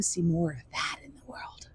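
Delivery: breathy whisper, barely voiced; whispered wish. Real, not acted. Telling viewers her hope for the world, in a whisper.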